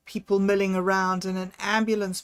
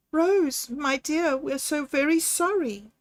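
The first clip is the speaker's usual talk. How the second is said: higher, lightly voiced grieving grandmother; 7 semitones higher than usual. Grandmother consoles Rose after her husband's death, higher. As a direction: offer sorrow gently, pitched up. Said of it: "his grandfather's voice shook".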